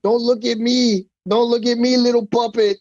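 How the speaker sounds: a hint of the movie villain; movie quote. Coach quoting American Me while choking a student. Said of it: "and I went".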